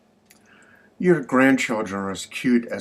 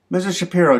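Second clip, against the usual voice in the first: higher, louder acted address; mild exasperation; 8 semitones higher, 5 dB louder, much faster than usual. Clerk at a loss with Missus Shapiro, mildly exasperated. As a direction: call her name up high, lightly fed up.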